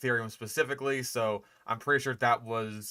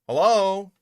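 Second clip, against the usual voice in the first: loud calling hello; 6 semitones higher, 8 dB louder, slower than usual. Real, not acted. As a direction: call out to get attention. Calling out for response.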